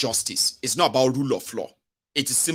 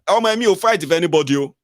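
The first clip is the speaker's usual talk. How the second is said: higher, louder, quick protest; mock outburst, raised and rushed; acted protest; 4 semitones higher, 6 dB louder, faster than usual. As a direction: blurt it out fast and loud in mock protest. Acted quote of a quarrel.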